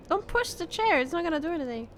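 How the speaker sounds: ordinary delivery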